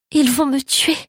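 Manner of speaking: put-on high, panicked cry of a hunted child; tearful fear. A narrator's reading. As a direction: cry it out in rising terror. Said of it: "pleurai-je".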